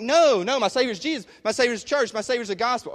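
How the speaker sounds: high, loud imitated objection; hammed-up pious denial; mock defensive protest. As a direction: insist too quickly, as a defensive churchgoer. Acting out congregation's defensive objection.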